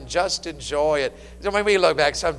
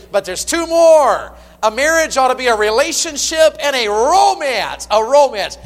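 loud, high, ringing sermon delivery; full-force preaching; 11 semitones higher, 10 dB louder than usual. Acted. Pastor exhorting married couples, loud and high. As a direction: preach it out to the whole church.

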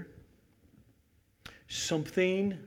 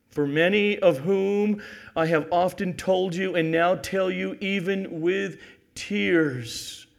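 raised preacher's voice, drawing out the ending; forceful scripture reading; 7 semitones higher, 9 dB louder, slower than usual. Real, not acted. Preacher quoting Paul's letter to believers, forceful reading. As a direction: declaim the verse with force.

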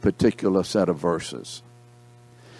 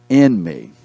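louder "in" after a pause; stress on IN; 2 semitones lower, 6 dB louder than usual. Real, not acted. Preacher reading scripture to class, stressing "in". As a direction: punch "in", let "me" fall away. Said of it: "The emphasis is being in".

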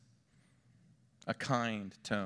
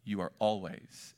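normal talking voice

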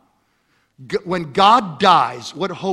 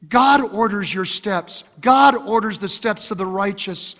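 ordinary talk

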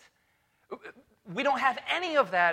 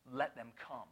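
hushed command; 6 semitones lower, 12 dB quieter than usual. Retold or imitated. Jesus's command voiced quietly.

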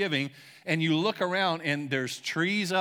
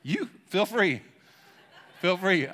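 laughing invitation; 4 semitones higher, 3 dB louder, slower than usual. Real, not acted. Joking about wanting gifts.

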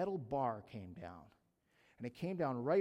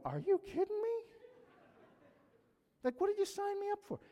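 much higher, replaying the shock on the ride; retold disbelief; 4 dB louder than usual. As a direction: yelp it like the loop just hit. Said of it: "And I'm going".

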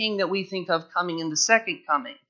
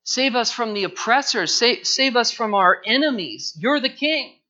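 rising, louder cry of a crowd begging rescue; crowd shouting; 5 semitones higher, 6 dB louder than usual. Retold or imitated. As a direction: call it out higher and louder like the crowd. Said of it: "They're shouting".

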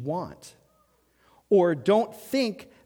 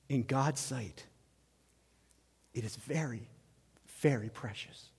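much softer, intimate reassurance; tender hush; 5 semitones lower, 9 dB quieter than usual. Real, not acted. Pastor reassuring wives they are precious, hushed and tender. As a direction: lower the voice to comfort them.

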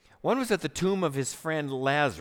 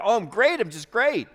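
bright, falsely upbeat "I'm great"; fake cheerful front; 6 semitones higher, 5 dB louder than usual. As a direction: pretend everything is fine. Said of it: "we put on this good front".

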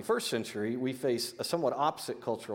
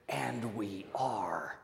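hushed whisper; 4 semitones lower, 6 dB quieter, much slower than usual. Real, not acted. Preacher closing the verse to his church, slow whisper. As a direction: whisper slowly, almost breathless.

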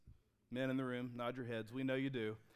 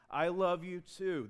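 louder, higher, slow I love you too; warm emphatic reply; 5 semitones higher, 6 dB louder, slower than usual. Acted. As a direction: savour the right answer. The good answer repeated.

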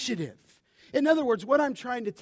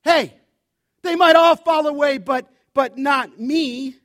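cocky shout; 10 dB louder than usual. Retold or imitated. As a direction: shout it like nobody could make you fall. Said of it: "Peter's like".

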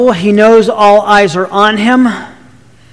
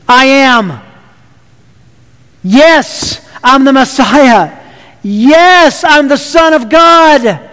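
bold declaration; 2 semitones higher, slower than usual. Acted. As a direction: ring it out, unashamed. Jesus boldly affirms before the council.

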